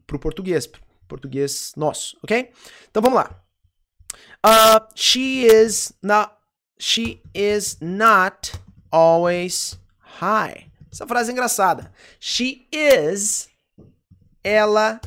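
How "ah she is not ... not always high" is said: slow dictation. Shown as a demonstration. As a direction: read it out slowly for the class to repeat. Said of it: "teacher dictating slowly".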